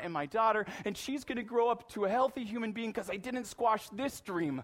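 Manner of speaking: proud canoe owner. Retold or imitated. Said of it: "I'm like".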